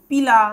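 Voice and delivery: পিলা said as a separate short unit; pronunciation model. Shown as a demonstration. Teacher showing students the first part of the name, pronunciation model of পিলা. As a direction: say পিলা on its own, clearly. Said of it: "প্রথমে এইটুকু বলবো".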